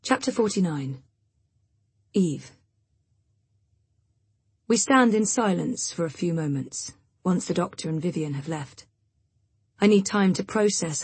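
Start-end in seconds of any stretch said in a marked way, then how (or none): none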